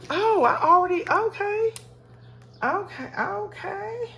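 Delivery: high, rising surprised okays; surprised realization. Real, not acted. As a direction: caught off guard, pitch jumps way up. Surprised finding money added.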